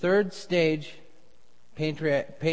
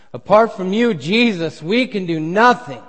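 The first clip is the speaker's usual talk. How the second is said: louder, higher plea to Jesus; fervent prayer; 4 semitones higher, 10 dB louder than usual. Acted. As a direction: cry it out to Jesus.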